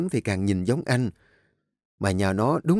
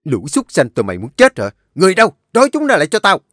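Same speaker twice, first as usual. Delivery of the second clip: roaring, much higher and louder; furious shout; 7 semitones higher, 8 dB louder, faster than usual. A narrator's reading. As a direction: bark the orders at the caught lovers. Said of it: "chợt có tiếng quát vang lên".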